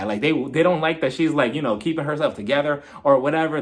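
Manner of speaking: ordinary delivery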